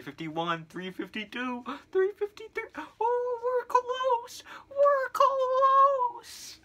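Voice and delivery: rising, squealing with excitement; excited countdown. Real, not acted. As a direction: build up high and thrilled. Countdown to four minute mark.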